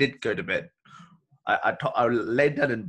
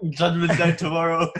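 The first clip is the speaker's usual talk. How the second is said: loud high mock panic; hammed-up doomsday cry; 8 semitones higher, 9 dB louder than usual. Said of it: "I go".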